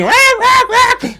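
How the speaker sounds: very high, loud imitated parrot squawks; parrot squawking. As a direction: screech like a frantic trapped bird. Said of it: "there's banging and squawking".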